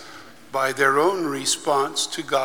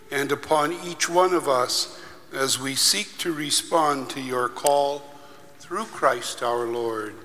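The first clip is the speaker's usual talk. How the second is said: prayer; 2 semitones higher, 4 dB quieter than usual. Acted. Leads a liturgical prayer.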